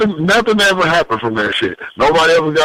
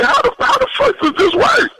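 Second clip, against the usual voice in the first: incredulous. Retold or imitated. Retold baffled reaction at traffic stop.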